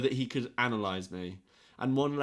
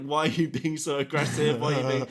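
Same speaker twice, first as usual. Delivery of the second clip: louder, higher mock accusation; retold confrontation; 4 semitones higher, 6 dB louder than usual. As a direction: round on the student, half laughing. Retold teacher latching on, confronting.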